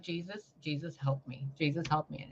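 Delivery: soft, pleading Jesus help me; mock desperate prayer. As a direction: quietly beg Jesus. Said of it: "I'm like".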